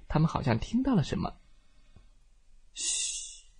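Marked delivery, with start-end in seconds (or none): 2.7-3.5 sharp unvoiced shh; hush. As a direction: shush them at once. Shushing the other. (嘘声)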